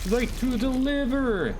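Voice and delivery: high, drawn-out exclamation; excited kill-shot callout. Real, not acted. Player cheers a shot to the liver, high and drawn out. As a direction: stretch it out high, dropping on the last word.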